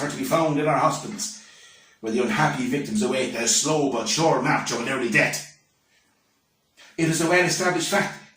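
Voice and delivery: solemn warning, key words punched; declamatory schoolbook recitation. Acted. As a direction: deliver a temperance lecture with mock-grave emphasis. Recites school reader's temperance lesson.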